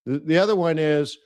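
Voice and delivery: ordinary talk, nothing marked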